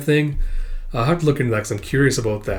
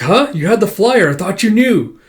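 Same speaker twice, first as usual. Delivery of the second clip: high and louder, caught off guard; surprised; 7 semitones higher, 5 dB louder than usual. Acted. A friend amazed he missed the flyer, high surprised voice. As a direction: blurt it out in disbelief.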